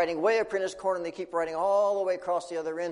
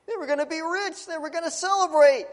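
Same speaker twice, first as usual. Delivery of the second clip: much higher, rising to loud; excited; 14 semitones higher, 6 dB louder than usual. A narrator's reading. Storyteller shares the children's glee, high and excited. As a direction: bubble over like thrilled kids.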